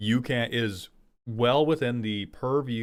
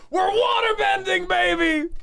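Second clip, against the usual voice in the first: higher, shouted mock celebration; hype cheer. Streamer shouting mock triumph at playing an island. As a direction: yell it like a big win.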